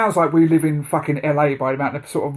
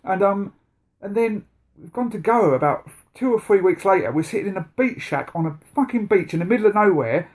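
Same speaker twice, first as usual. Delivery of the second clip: normal talking voice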